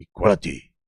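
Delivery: low, solemn parody of a keynote; mock Herman speech. Acting Herman's speech over music.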